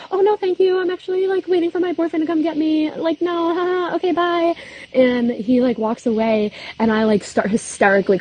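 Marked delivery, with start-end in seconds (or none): 0.1-4.5 high, bright fake-polite voice pitched way up; nervous polite brush-off. To the farmer, declining his offer in a high nervous voice. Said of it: "And I go".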